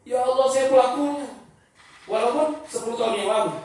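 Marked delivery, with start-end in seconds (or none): 0.1-1.3 higher-pitched pained lament; acted sorrow. A penitent hears of someone drunk, sorrowful confession. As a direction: lightly voice a grieving penitent. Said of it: "dia sedih".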